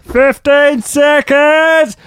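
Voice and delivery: high, slow coach shout; gym instructor impression. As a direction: bellow it like a trainer. Instructor call.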